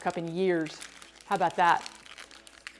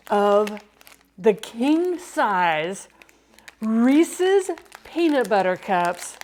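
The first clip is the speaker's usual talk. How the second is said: slow dramatic unveiling; much slower than usual. Acted.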